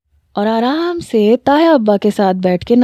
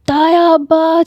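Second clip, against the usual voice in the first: much higher cry of tayaa abba; shouting for help; 8 semitones higher, much slower than usual. A narrator's reading. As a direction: yell for your uncle. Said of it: "چلانا شروع کر دیا".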